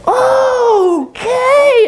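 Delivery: very high, drawn-out squeaky monkey; agreeable monkey. Acted. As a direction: squeal a long eager okay as a monkey. Monkey agrees to owl's idea.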